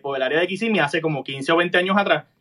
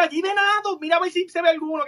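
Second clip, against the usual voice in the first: stepmother's voice; 10 semitones higher than usual. Retold or imitated. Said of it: "mi madrastra le dice".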